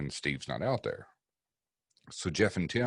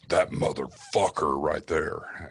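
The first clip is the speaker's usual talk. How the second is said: not his own voice; 3 dB louder, slower than usual. Retold or imitated. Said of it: "going from his voice to not his voice".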